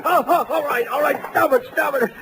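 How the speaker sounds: high, pained pleading to stop; pained giving in. Acted. Thug shaken by the detective, pleading high and pained. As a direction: beg him to stop shaking you.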